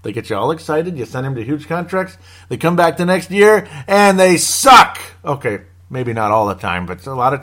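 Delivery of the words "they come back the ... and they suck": loud, high rant building to a shout; rising, exasperated outburst. Real, not acted. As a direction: yell the punchline, stretching the last word. Exasperated punchline of contract-year rant.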